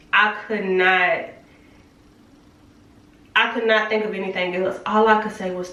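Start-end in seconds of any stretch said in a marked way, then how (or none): none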